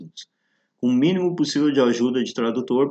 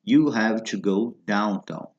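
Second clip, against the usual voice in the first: slow model phrase; slower than usual. Shown as a demonstration. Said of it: "quando se diz".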